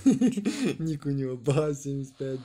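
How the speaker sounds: laughing tease. Real, not acted. Teasing nickname chant, laughing.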